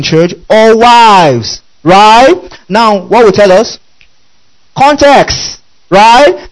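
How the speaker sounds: high, loud, drawn-out preacher's call; fiery preaching. Acted. Preacher pressing the congregation for the answer, rising fervour. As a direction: rouse the congregation, pressing each question. Preaching climax, pitch and volume up.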